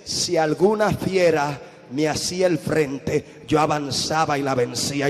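slow muted first reading of the verse; slow quiet reading. Retold or imitated. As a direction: read it cautiously, slowly and low. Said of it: "Lee bien".

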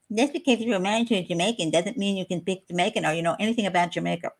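lightly snotty know-it-all imitation; mock a snooty critic, mildly. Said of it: "get really snotty and say".

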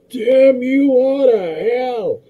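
high, drawn-out anguished movie cry; Charlton Heston impression. Podcaster quoting Planet of the Apes, in a hammy Heston cry. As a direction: stretch the curse out big and theatrical. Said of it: "if I watch Planet of the Apes".

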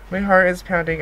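ordinary talk, nothing marked

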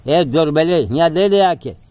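loud coaxing call across to the monkey. Retold or imitated. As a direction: call the weak monkey over loudly. Calling a monkey to food. (ज़ोर से बंदर को पुकारना)

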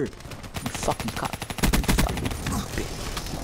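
slow, voiceless angry muttering; seething under breath. Real, not acted. Gamer cursing his killer to himself, seething quietly. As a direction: curse softly, almost whispered.

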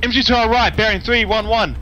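urgent callout. Real, not acted. Urgent callout of enemy infantry.

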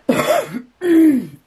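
loud, high rough coughing fit; real cough. Real, not acted. Reader with a head cold breaking off for a loud cough. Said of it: "I got a cough".

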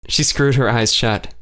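usual speaking voice

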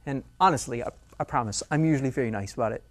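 ordinary talk, nothing marked

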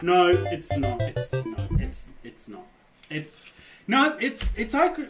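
ordinary talk

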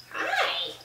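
sweet squeaky pet voice. Real, not acted. Greeting the cat, baby talk.

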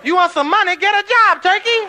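very high, loud yell; shrieked mock put-down; scornful heckler. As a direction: holler from the cheap seats. Audience yells at the guru.

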